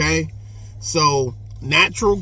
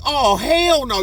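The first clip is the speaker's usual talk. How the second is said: mock prisoner; 8 semitones higher than usual. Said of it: "you be like".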